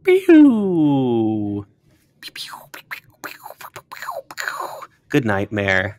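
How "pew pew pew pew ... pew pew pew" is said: laser pew sound effects, fading to breathy; powering down noise. Pretend. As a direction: zap sounds trailing off unvoiced. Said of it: "I thought you were doing the "that's me powering down" noise".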